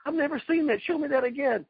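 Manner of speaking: high, eager mimicry of an amazed opponent; kid in candy store. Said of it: "like a kid in a candy store".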